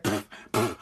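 quiet breathy pff, pff, brushing people off; soft scoffing puffs. Retold or imitated. Podcaster mimics himself scoffing at the crowd, quiet scoffing puffs. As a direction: puff out a dismissive little scoff. Imitating own walk-in scoff.

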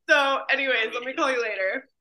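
ordinary delivery

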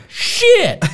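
high-pitched mock cry of frustration; exasperated retold curse. Retold exasperation after thermostat fails again.

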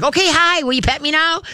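very high, excited puppy voice; eager dog voice. Acted. Trainer voices a wagging dog asking for petting, high and eager.

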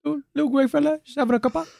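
high, hammed-up British bloke; mock UK accent. Cohost doing a goofy British accent about the alien. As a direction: put on a silly British accent. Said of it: "how do you like our various UK accents".

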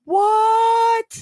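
high, outraged "What?"; shocked. Real, not acted. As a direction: shriek it in disbelief. Partner insults her voice.

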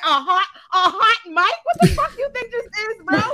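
indignant, high and loud; pissed off. Real, not acted. Host reacts to the hot mic claim, pissed off. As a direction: throw it back in angry disbelief. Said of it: "That pissed me right off".

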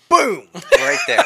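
high, loud teasing boom; gleeful boom. Real, not acted. Teasing co-host who just spoiled the show, gleeful. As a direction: slam it down like a mic drop.

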